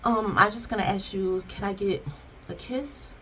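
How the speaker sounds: soft, fading to nearly inaudible; tentative request. Retold or imitated. Wife shyly asking husband for a kiss, tentative. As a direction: gather courage and ask very quietly. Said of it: "she take that moment of courage".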